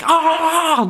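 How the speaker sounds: very high cartoon cheer of an adoring crowd; mock crowd cheer. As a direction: squeal like a thrilled crowd.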